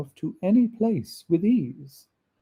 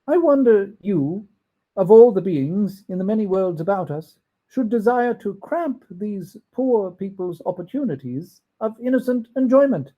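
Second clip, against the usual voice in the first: put-on higher, louder old man reproaching; indignant Scrooge; 5 semitones higher, 3 dB louder than usual. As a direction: challenge the ghost, earnest and shocked.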